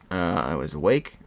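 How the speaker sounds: normal talking voice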